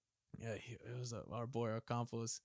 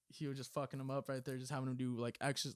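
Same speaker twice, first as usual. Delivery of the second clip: ordinary talk, nothing marked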